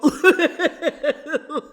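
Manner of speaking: high burst of laughter; cackling; loud laugh. Real, not acted. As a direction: crack up at your own roast. Laughs at her Urkel joke.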